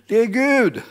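loud high proclamation of God; proclaiming. Real, not acted. Preacher to congregation, loud high proclamation. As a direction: ring it out to the congregation.

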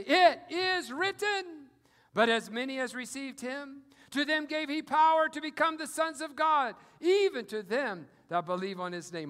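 loud, ringing scripture proclamation; bold rebuke. Acted. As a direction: declare it to Satan's face. Believer answering Satan loudly.

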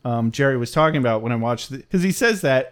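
usual speaking voice

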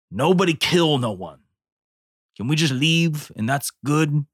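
usual speaking voice

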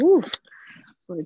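ordinary talk

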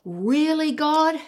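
high, loud, disbelieving cry of protest; voicing people's loud complaint at God; incredulous shout. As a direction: call it out loud, as if God owes an answer. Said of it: "Many people will shout".

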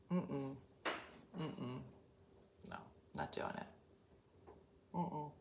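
whispered refusal. Real, not acted. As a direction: hush it out, flatly refusing. Refusing, under breath.

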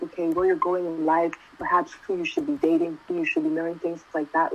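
usual speaking voice

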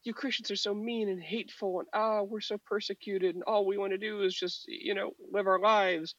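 high, whiny mock persecuted tone, slowing at the end; mock victim voice. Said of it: "it's not like they're like".

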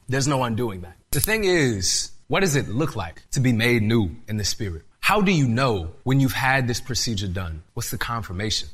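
ordinary talk

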